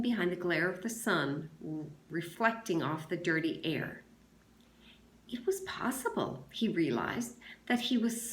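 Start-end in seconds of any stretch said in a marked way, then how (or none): none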